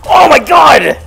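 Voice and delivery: high, loud shout of fright; startled shout. Real, not acted. Gamer jumping at an enemy, startled shout. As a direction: yell out at the jump scare. Sudden scare in horror game.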